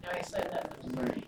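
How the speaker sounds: whispered joking aside. Real, not acted. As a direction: drop to a whisper, as if nobody should hear. Joking aside about her husband.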